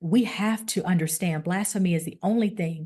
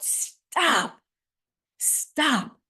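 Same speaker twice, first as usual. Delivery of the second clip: retold scream; 7 semitones higher than usual. Preacher voicing Paul stopping the jailer, half-screamed. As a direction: cry out stop as a mild retold scream. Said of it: "Paul screamed out".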